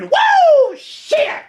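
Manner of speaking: high, loud woo then a dropped shit; reading a whoop. Retold or imitated. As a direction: whoop it out as you read it.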